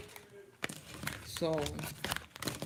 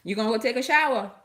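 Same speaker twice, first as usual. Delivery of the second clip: quick, loud and higher, tossed across the room; calling out; 4 semitones higher, 7 dB louder, much faster than usual. Real, not acted. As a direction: fire the question fast across the room.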